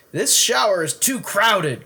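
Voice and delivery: a put-upon dad, complaining. Acted. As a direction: whine about the crowded shower. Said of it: "complained Lewis's father".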